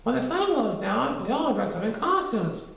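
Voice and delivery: put-on high boyish Christopher Robin voice; young Christopher Robin explaining. A narrator's reading.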